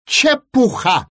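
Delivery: put-on young witch waving away the warning; dismissive retort. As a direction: brush it off defiantly. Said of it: "возразила маленькая баба Яга".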